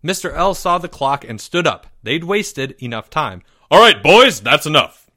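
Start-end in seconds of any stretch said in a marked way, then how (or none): 3.7-5.1 loud, curt and businesslike; brisk. A narrator's reading. Teacher ending the celebration, loud and brisk. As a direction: cut them off firmly. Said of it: "he said briskly".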